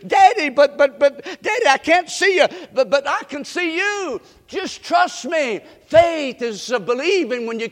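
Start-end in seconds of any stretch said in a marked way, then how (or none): 0.1-2.5 put-on loud, high, halting frightened child; stammering child calling down in panic; scared little girl. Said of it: "The little girl". 2.7-5.6 father reassuring. Retold or imitated. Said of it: "And the daddy said".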